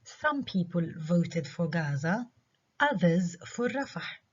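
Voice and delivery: others and Rafah stressed, slow read; slowly, leaning on others and Rafah; contrastive stress. Shown as a demonstration. Teacher repeating the example with contrast stress. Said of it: "رفح عليها stress لأنها إجت in contrast".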